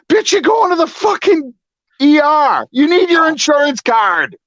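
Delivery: high-pitched, incredulous rant; exasperated outburst. Retold or imitated. Man waiting in the ER fuming at the patient ahead, exasperated high outburst. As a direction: burst out high in exasperation at her stupidity. Mad retort to patient in story.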